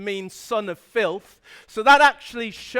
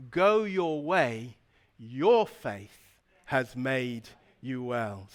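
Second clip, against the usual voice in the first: very low, soft and slow; solemn gentle pronouncement; 10 semitones lower, 6 dB quieter, slower than usual. Retold or imitated. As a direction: let each phrase settle quietly. Repeats Jesus's healing words for emphasis.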